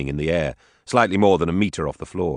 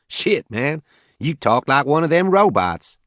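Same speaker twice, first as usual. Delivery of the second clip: loud, higher Southern farmer boasting; southern accent; 10 semitones higher, 4 dB louder, slower than usual. Acted. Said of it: "He had a heavy southern accent".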